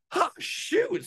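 high-pitched mock gasp, then dismay; startled realisation. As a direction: act out suddenly noticing your mistake. Retold discovery of being off course.

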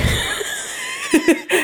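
high burst of laughter; shrieking laugh. Real, not acted. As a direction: squeal with laughter. Laughing about break being seen.

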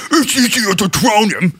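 slow, badly faked Yorkshire; overdone Yorkshire attempt, drawn out; Yorkshire accent. Student copies the software's phrase, clumsy slow Yorkshire accent.

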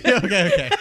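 laughing, high and loud. Real, not acted. Laughing after creepy remark.

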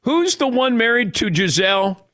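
high-pitched mock question; clueless casual fan. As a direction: imitate a football newcomer asking out of nowhere. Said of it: "get ready for the questions that will come out of nowhere".

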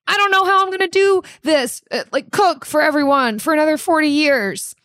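high, fast, melodramatic despair; despairing home cook. Acted. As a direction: rush it out in overwhelmed panic. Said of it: "making statements like".

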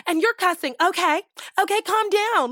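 high, loud mock telling-off; cussing-out. Bystander mimicking a viral cussing-out, loud and high. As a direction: imitate a heated stranger from a video. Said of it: "see a stranger getting cussed out".

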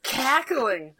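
slow, stretched-out word; drawn-out emphasis. Real, not acted. Describing a weird laughing man, slow emphasis. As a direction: draw out "cackling" with relish.

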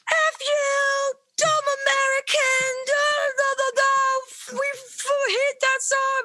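high, hammed-up mocking heckler voice; angry British heckler. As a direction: shriek it like an outraged local. Retelling angry Brits over Wonderwall.

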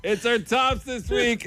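laughing. Real, not acted. Laughing while introducing the list.

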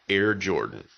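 ordinary delivery

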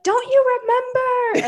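high, loud, drawn-out imitation scream; mock scream. Followers screaming at her to remember about Ashley, mock scream. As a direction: wail it slowly like an outraged fan. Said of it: "screaming at me".

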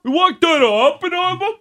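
very high-pitched cartoony Massachusetts accent. Co-host echoing the host's outburst, in a cartoony Massachusetts accent. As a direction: squeal it in broad, mocking Massachusetts. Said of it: "it's a Massachusetts accent".

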